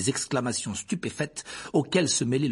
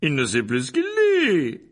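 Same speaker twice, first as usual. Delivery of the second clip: loud, higher and slow, booming to the crowd; trumpeting voice; 5 semitones higher, 7 dB louder, slower than usual. A narrator's reading. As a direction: trumpet it over the students' heads. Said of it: "d'une voix claironnante".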